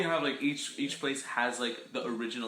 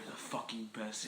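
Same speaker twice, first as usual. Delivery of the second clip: mock whisper; 3 semitones lower, 10 dB quieter than usual. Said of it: "He probably just like".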